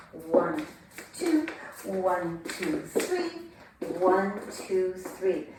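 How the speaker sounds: rhythmic counting to the beat; dance count. Shown as a demonstration. Teacher counting for Wayne, rhythmic. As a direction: count the steps evenly. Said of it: "counting dance steps".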